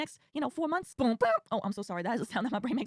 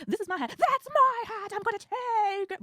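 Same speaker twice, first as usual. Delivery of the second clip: higher, louder, drawn-out grab; mock rider quarrel; 8 semitones higher, 3 dB louder, slower than usual. Subway quarrel over a hat, mock squeal then slow. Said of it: "And he's like".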